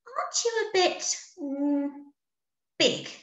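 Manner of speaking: high, suspicious little bee; a clever bee who smells a rat; Amelia's doubt. A narrator's reading. Amelia questioning Norman's size, high and doubtful.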